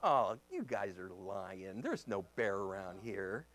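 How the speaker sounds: low, soft, slow dismissal; playing dumb. Pastor to junior highers about bear, playing dumb. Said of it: "I kinda played dumb".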